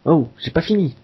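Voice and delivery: mock irritated boss, rising pitch; angry boss. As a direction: play the annoyed boss. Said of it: "Le patron s'énerve".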